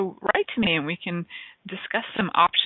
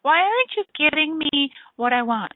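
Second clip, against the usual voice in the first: whiny complaining to the universe; 8 semitones higher than usual. Acted. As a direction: whine at the sky like a spoiled child. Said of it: "Instead of going".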